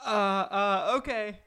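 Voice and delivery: stammering surprise. Pretend. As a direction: caught off guard, fumble the yes. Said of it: "Zacchaeus' reaction was".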